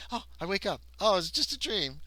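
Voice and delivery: lightly acted surprise; waking with a start. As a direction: act out waking from a dream. Acting out waking from dream.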